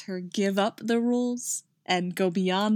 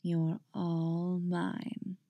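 slow, softer seductive murmur; dark promise; 6 dB quieter, much slower than usual. Acted. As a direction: lure her in slowly. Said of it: "voice full of dark promise".

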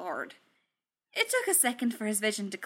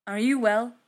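louder, worried check; concerned; 2 semitones higher, 8 dB louder than usual. A narrator's reading. Thor leaning over fallen Tony, concerned. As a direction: ask with anxious force. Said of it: "his face twisted with concern".

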